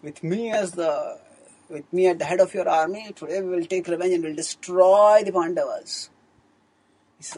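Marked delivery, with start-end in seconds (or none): 0.0-6.1 loud, raised war cry of vengeance; rally the king with fury; loud defiant rallying call; angry battle cry. Retold or imitated. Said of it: "Ashwatthama was filled with anger".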